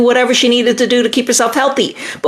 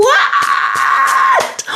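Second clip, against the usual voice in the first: shocked shout. Real, not acted. Shocked by a viewer's news.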